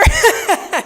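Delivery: loud high laugh; burst of laughter. Real, not acted. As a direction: laugh out at the friend. Laughing at her friend Cindy.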